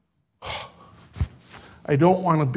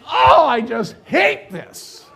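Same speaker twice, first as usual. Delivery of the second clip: laughing dismay; 7 semitones higher, 5 dB louder, slower than usual. Retold or imitated. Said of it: "and I'm like".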